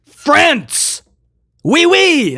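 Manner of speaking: high-pitched playful exclamation; hammed-up burst of French enthusiasm; mock French cheer. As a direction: cry it out high like a silly, excited cheer.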